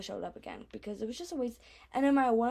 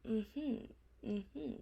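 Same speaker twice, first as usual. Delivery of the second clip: sheepish mm-hmm; 8 dB quieter than usual. Retold or imitated. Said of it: "all I was like".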